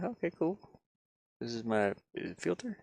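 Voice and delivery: ordinary talk, nothing marked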